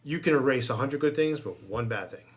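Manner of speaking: normal talking voice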